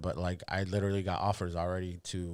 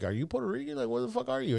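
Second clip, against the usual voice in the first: puzzled questioning people; 14 semitones higher than usual. Retold or imitated. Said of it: "people are like".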